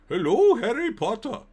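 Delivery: high, loud put-on Saudi Arabian accent, badly done; failed Saudi accent. Streamer imagines a Saudi owner greeting Harry Potter, high loud Saudi Arabian accent attempt. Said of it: "I can't do a Saudi Arabia accent".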